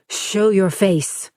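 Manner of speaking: a woman drawing her gun, growling; growled. A narrator's reading. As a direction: growl it out slowly. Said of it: "she growled".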